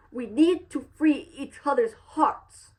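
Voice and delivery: high, put-on character impression; Joe Cross. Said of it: "doing my best Joe Cross impression".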